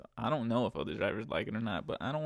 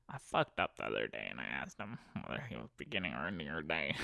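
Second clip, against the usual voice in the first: lower, softer embarrassed admission; mock sheepish confession; 9 semitones lower, 6 dB quieter than usual. Driver imagining passenger admitting a mistake, lower sheepish. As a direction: mumble it like you're ashamed.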